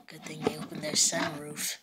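sneaky robber whisper. Acted. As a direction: hush it like a plotting thief. Robbers plotting in the car.

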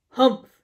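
short, loud grumpy huff; grumbled humph. A narrator's reading. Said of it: "Bear grumbled".

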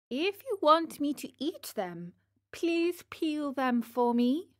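high, wheedling Pinocchio voice; pleading puppet child. Acted. Pinocchio asking Geppetto to peel the pears, high plea. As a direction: ask sweetly and high, lifting at the end.

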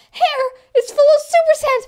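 high crying wail. Acted. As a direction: wail in dismay. First take, crying.

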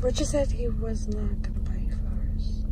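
soft unvoiced whisper. Real, not acted. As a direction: whisper it close. Whispers beside patient.

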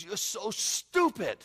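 high, slow, drawn-out taunt; loud gloating ridicule; mocking enemy. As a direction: jeer loudly at the fool you tricked. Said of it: "He goofs on you".

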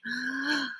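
out of breath from running; breathless gasp. A narrator's reading. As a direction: pant for air after the run. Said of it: "Out of breath".

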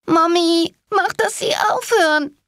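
put-on very high, pleading child voice; high-pitched childlike whimpering plea; whining child. A narrator's reading. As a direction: wail to mummy like a scared kid. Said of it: "jammerte ein Kind".

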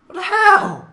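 high, loud squawk of disbelief; incredulous outburst. Real, not acted. Commentator reacts to vanished clouds, high incredulous yell.

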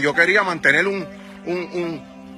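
ordinary delivery

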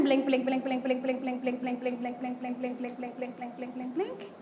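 fast, monotone patter fading to very soft; rapid rhythmic repetition. Shown as a demonstration. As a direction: let the steady stream of blinks dwindle away. Counting out rapid exaggerated blinks.

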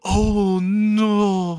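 high, drawn-out panicked wail; hammed-up scream of disbelief; mock scream. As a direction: cry out slowly, high and loud. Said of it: "he screams out".

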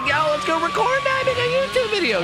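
high, eager mock hype; excited. Youtuber recalls getting hyped for an idea, high and eager. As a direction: act out your own excited self. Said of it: "all excited for an idea".

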